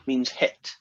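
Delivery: ordinary talk, nothing marked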